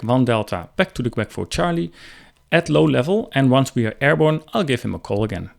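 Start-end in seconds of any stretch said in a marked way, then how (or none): none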